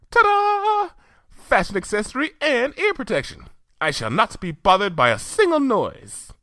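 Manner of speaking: very high theatrical flourish; Rarity voice. Acted. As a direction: sing out the ta-da high and proud.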